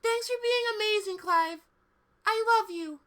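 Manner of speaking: put-on grateful squeaky girl voice; high sweet cartoon girl. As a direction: thank him in a high girly voice.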